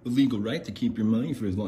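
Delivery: snide corporate drone lecturing the customer; mocking eBay cronies. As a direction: parody a condescending support rep. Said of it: "they'll have all these little cronies go".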